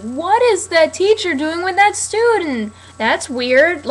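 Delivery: high, slow, gawking bystander voice; shocked onlooker. Retold or imitated. Viewer mocking the show, voicing a scandalised bystander. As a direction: play a scandalised viewer staring at the screen. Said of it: "like, be like".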